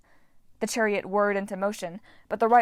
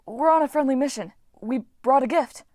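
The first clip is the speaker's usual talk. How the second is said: pleading; 5 semitones higher, 6 dB louder than usual. Acted. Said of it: "Frank pleaded".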